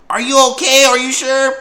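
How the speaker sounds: high, loud worried mother; mom's worried voice. Retold or imitated. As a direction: call through the door like an anxious mom. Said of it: "her mom was like".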